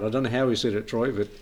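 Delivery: usual speaking voice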